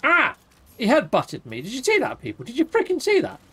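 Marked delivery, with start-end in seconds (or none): 0.0-0.4 sudden high yelp of shock; a short loud cry as he's hit; startled yelp. Real, not acted. Creature headbutts him. 2.4-3.4 outraged disbelief. Real, not acted. Indignant after being headbutted.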